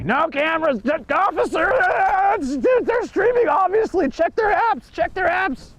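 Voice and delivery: shrill mock tantrum at the officer; duck version of drunk lady. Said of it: "the duck version of that lady".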